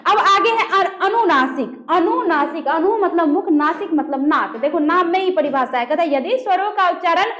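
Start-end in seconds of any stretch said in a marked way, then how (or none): none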